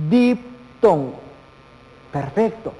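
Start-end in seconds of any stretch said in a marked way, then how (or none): 0.1-1.1 "diptongo" slowly as dip-tongo; syllable split. Shown as a demonstration. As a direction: break "diptongo" after "dip". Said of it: "habíamos mencionado que iba a ser el". ("diptongo" lento, con pausa tras "dip")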